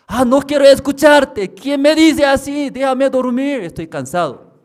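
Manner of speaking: high, loud whiny complaint; woman woken at night, high and loud; annoyed sleeper. Retold or imitated. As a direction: push the voice away, half asleep. Woman covering her ears.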